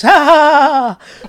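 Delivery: imitated late, forced "ha ha"; fake laugh. Telling friends about a kid who missed the jokes, fake laugh. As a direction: mimic a kid laughing along without getting it. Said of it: "I should be laughing too".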